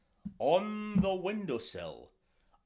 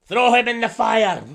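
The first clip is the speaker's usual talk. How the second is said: louder, cold order to burn him; higher, loud royal decree; sultan command; 7 semitones higher, 13 dB louder than usual. Acted. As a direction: condemn him with relish. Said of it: "And the Sultan said".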